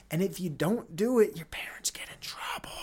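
whispered aside; hushed conspiratorial whisper. Real, not acted. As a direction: drop to a secretive whisper. Conspiratorial aside about compulsory school.

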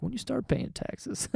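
mock-naive self imitation; mocking himself. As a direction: parrot yourself like a clueless kid. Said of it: "I sound so dumb".

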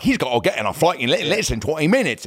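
caricatured British manager voice; British caricature. Retold or imitated. Chauffeur manager refuses the picture, British caricature. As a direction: put on a cartoonish British minder's accent. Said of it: "a caricature of a, of a British guy".

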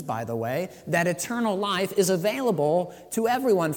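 loud, high, fervent sermon; preaching at full force. Acted. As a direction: build it up and drive it home. Preaching to the congregation.

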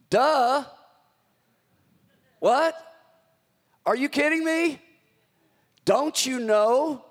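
slow mocking are you kidding me; incredulous duh. Shown as a demonstration. Preacher voices Paul to church, exasperated disbelief. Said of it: "I believe he's saying it like".

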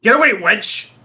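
mock old-timey rebuff.

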